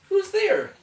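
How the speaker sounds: very high squeaky mouse call; mouse crying out. A narrator's reading. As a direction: squeal it like a startled little mouse. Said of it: "Mouse cries".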